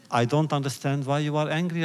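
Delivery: ordinary delivery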